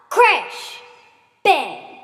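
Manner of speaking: punchy loud sound-effect words; dramatic crash and bang. A narrator's reading. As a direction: call out the crash and bang with force.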